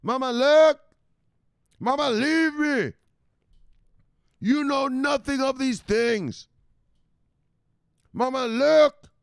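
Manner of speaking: high, loud whiny son's voice; high-pitched son pestering his mother; Michelle. Acted. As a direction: plead with mama to look. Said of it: "this is what Michelle says".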